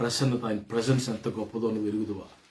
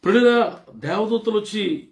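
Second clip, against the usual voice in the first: urgent preaching question; 9 semitones higher, 6 dB louder, faster than usual. Acted. Preacher voices angels questioning Mary.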